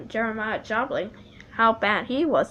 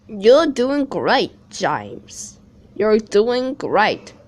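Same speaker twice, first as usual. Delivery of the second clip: encouraging; 7 dB louder, much slower than usual. Acted. Edward encouraging James.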